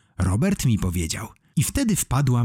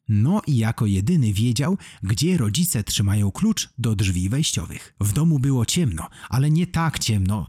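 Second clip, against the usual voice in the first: plain reading voice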